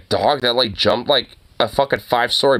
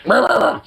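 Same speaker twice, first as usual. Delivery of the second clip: high, mock rapid barking; imitated dog barking; 11 semitones higher than usual. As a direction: mimic a dog yapping at noises. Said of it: "you just have to be like".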